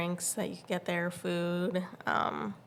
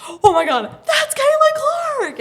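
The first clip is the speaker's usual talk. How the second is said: very high, loud squeal; gasp, then scream it, stretching the name; reenacted fangirl meltdown; starstruck shriek; 14 dB louder than usual. Spotting the star player.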